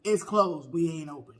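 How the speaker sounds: low, lightly voiced curt lady; curt desk lady. Desk lady turning them away, mildly mock curt. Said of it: "That's all she had to say".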